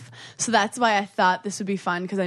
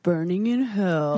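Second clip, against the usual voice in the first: slow, drawn-out devil voice; Satan voice; 3 semitones lower, slower than usual. Pretend. Podcast guest announcing her show's name in a playful devil voice. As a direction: intone it slowly as a mock Satan. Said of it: "That was my Satan voice".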